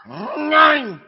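Nein barked high and loud in German; hammed-up German Nein; exaggerated German nein. Shown as a demonstration. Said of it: "you could really sound German there".

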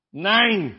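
Nein said simply, moderate; a plain German Nein. Shown as a demonstration. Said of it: "you could really sound German there".

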